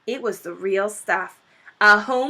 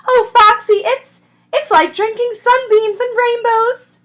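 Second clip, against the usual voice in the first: ecstatic; 14 semitones higher, 9 dB louder than usual. Acted. Badger ecstatic over cider.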